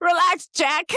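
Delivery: loud, high, teasing; high mocking voice. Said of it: "It's like".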